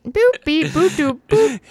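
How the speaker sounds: high beeping robot sounds; robot noises. Pretend. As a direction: beep like a toy robot. Robot friend joke voice.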